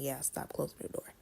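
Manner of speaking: whispered agreement from Nana; soft whispered aside; quiet aside. Retold or imitated. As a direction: murmur it under breath. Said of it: "my Nana was right there saying".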